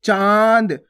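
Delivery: चाँद with a long vowel through nose and mouth; nasalised vowel. Shown as a demonstration. Teacher adding the chandrabindu, long nasalised चाँद. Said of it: "नाक और मुंह दोनों से उच्चारण हो रहा है".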